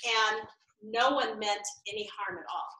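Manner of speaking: ordinary talk, nothing marked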